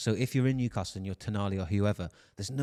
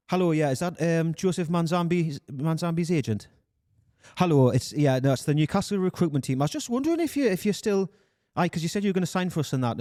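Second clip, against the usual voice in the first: animated Geordie phone voice, pitched up and louder; Newcastle accent; 6 semitones higher, 4 dB louder than usual. Acted. Recruiter phoning a player's agent, Newcastle accent. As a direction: put on a Geordie accent, slightly raised. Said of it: "Newcastle accent than this".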